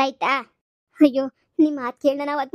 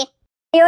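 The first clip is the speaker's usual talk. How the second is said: pleading wail; 2 semitones higher, 11 dB louder, much slower than usual. Acted. Mother wails begging in-laws.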